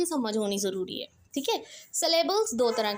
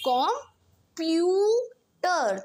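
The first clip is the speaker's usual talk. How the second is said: Computer very slow and higher, प्यू held long and strong; stress on PYU; 4 semitones higher, 3 dB louder, much slower than usual. Shown as a demonstration. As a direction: break up computer and lean hard on the middle प्यू. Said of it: "पी यू पे मैं बहुत ज़्यादा stress दे रही हूँ".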